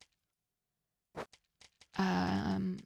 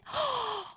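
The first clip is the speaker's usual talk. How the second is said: gasp; 4 dB quieter than usual. Real, not acted. Surprised by short timer.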